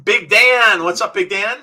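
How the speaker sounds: loud, high hail; big boisterous welcome; calling out a greeting. Real, not acted. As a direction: shout hello to a friend joining in.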